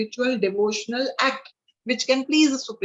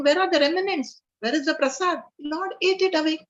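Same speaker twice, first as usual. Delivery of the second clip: stunned father; 5 semitones higher than usual. A narrator's reading. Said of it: "his father is stunned".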